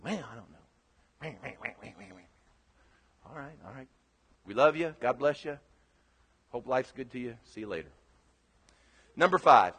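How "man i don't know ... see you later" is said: much softer, dismissive goodbye; mock brush-off. Departing member brushing off the pastor, softly. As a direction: let them off softly as if already leaving. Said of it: "They'll go".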